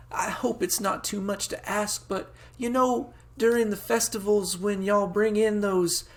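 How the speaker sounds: high country-mother voice, hesitant request; high-pitched, rambling rural mom; pleading farm mom. Acted. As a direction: ask sweetly as a folksy farm lady. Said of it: "accent I'm, like, flicking through".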